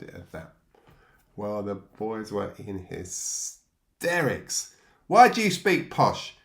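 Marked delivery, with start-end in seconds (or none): 5.1-6.4 loud, much higher jeering challenge, slow; menacing demand. Retold or imitated. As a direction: sneer it at him like the gang's top bully. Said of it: "the most menacing of the boys demanded".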